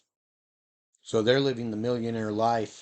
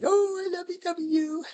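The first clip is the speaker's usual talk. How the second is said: squeaky gushing voice, much higher; gushing people. Retold or imitated. People misreading the dog, silly high gushing. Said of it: "And they're like".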